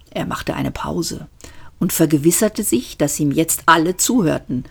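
ordinary delivery, nothing marked

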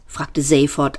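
plain reading voice

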